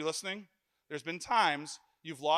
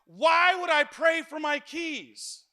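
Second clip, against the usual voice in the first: louder, higher, slow indignant question; indignant retort; 10 semitones higher, 9 dB louder, slower than usual. Retold or imitated. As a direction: push back, offended by the idea. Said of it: "did you pray about it?".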